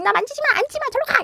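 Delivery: put-on a high kid's whine pushing the parent away; whiny child refusing. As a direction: sound like a cranky toddler.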